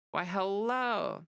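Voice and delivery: slow, high, exaggerated "Why, hello"; drawn-out greeting. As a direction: stretch it out like a charming host. Playful greeting to viewer Andrew.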